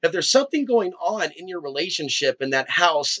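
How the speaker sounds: ordinary talk, nothing marked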